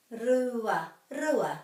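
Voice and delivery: rua with a rolled r, not English r. Shown as a demonstration. Teacher demonstrates rua with a rolled r. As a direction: tap the r in rua. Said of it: "So the r is rolled in te reo".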